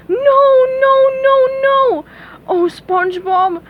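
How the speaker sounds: high desperate crying plea; distressed princess. Acted. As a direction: wail for help, near tears. Princess cries in despair.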